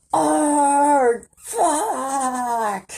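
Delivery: drawn-out, high, loud yell; yelling kid. Retold or imitated. Imitating a kid on Doctor Phil cursing at his parents, high yell. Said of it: "he just yelled".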